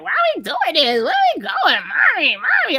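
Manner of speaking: high-pitched imitation of kids complaining to mom; mock kiddie whine; whining children. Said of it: "they'd be like ... mommy".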